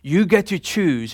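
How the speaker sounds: ordinary talk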